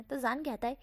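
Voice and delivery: usual speaking voice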